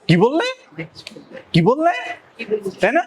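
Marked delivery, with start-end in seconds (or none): 0.1-1.9 sharply rising "what did you say?"; mock-offended questioning; indignant challenge. Shown as a demonstration. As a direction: demand a repeat, pitch swinging up. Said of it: "আমাকে খুব রাগান্বিত হলো".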